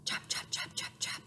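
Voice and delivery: soft whispered chops. Shown as a demonstration. Whispering chopping sounds for the children. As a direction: tap it out in a whisper.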